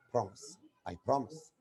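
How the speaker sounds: usual speaking voice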